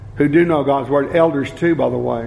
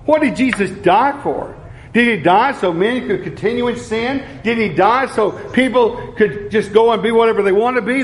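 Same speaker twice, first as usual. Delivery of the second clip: a touch higher, preaching with conviction; fervent preaching; 5 semitones higher than usual. Acted. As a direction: press each question home to the congregation. Preaching with rising fervour.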